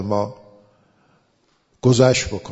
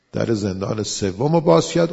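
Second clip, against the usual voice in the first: normal talking voice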